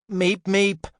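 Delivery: high loud cartoon bird call; Roadrunner meep. A narrator's reading. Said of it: "he shouted, imitating the Coyote Roadrunner".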